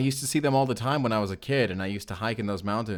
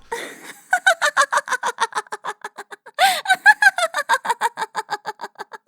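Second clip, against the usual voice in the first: high, loud embarrassed laugh; loud nervous laughter; flustered laughter; 8 dB louder than usual. Acted. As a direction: laugh it off, mortified. Embarrassed after the outburst.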